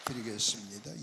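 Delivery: ordinary delivery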